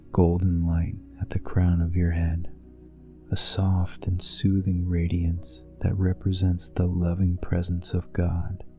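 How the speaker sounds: ordinary delivery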